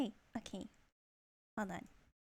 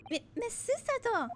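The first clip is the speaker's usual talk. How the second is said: higher-pitched stammered exclamation; flustered surprise; 9 semitones higher than usual. Acted. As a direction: stammer the name in startled alarm. Character startled by woman's arrival.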